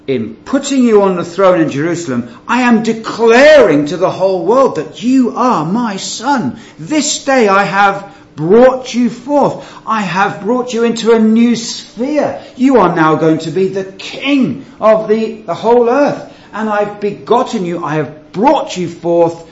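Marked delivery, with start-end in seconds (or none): 2.5-6.6 loud, high, ringing proclamation; preaching at full force. Acted. Preacher thundering God's words to the congregation, loud and high. 6.8-9.7 slow, higher, solemn pronouncement; lightly voiced God proclaiming; God's declaration. Preacher voicing God to his Son, slow and solemn. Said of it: "He is saying". 9.8-19.4 loud, high, ringing proclamation; preaching at full force. Acted. Preacher thundering God's words to the congregation, loud and high.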